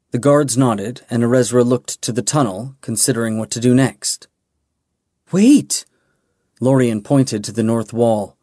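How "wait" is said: sudden call. A narrator's reading. Calling the group back.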